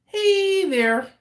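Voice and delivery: drawn-out, high, loud greeting; Rodney's catchphrase. Retold or imitated. Imitating a former coworker's saying, high sing-out greeting. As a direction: call it out high and loud, stretching the hey. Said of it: "That was how he said it".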